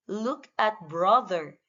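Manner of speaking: ordinary talk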